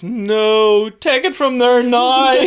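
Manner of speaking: put-on high, loud, slow child's demand; toddler's bossy shout. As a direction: play the toddler giving orders. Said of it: "And she goes".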